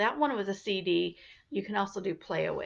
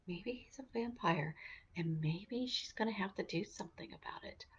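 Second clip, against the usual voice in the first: whisper; 11 dB quieter than usual. A narrator's reading. Vampire plot suspense.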